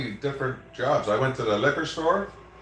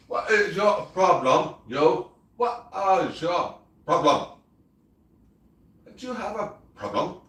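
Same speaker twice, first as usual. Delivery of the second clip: mock scolding; 6 semitones higher than usual. Scolding the dog playfully.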